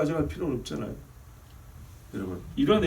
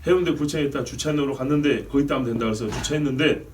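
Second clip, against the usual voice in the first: usual speaking voice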